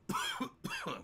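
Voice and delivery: sharp high coughing fit. Real, not acted. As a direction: cough hard mid-sentence. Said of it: "Sorry, I'm choking now".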